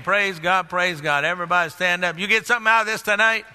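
ordinary delivery

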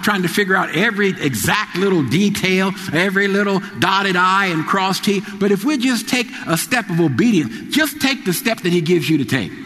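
impassioned pulpit exhortation; preaching. Acted. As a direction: build up the congregation with fervor. Preaching rise.

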